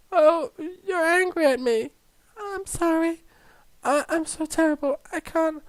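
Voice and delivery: high, small, tearful voice; soft high whimper, on the edge of sobbing; crying. Acted. Crying student apologising to the teacher, tearful. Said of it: "are you crying again".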